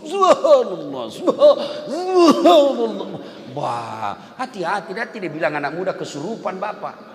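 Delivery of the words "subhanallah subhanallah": loud, high, slow hammed-up subhanallah; mock the over-the-top worshipper, loud; ecstatic shouted dhikr. Preacher mocking a worshipper shouting like in heaven. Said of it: "kayak dia sendiri masuk surga".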